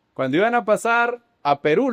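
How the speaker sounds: ordinary delivery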